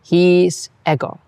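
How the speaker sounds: "His ego" slow, "ego" long-short-short; metrical Latin. Shown as a demonstration. Teacher modelling Latin meter, slow. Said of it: "pronounce the long vowels as long".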